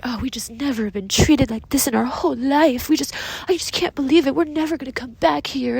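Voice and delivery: a hint of a whiny, offended diner; mock the huffy guest, lightly; passive-aggressive customer. Server mocking the rude table guest, mildly passive-aggressive. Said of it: "He's being very passive-aggressive".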